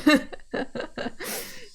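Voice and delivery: very high giggling fit; laughter. Real, not acted. Reader amused by the internal voice's accent, real high laughter. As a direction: squeal with laughter.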